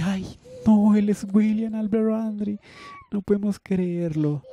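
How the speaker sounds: high, excited gasp of a starstruck fan; amazed crowd. Acted. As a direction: cry out in disbelief at the star. (multitud asombrada, voz aguda)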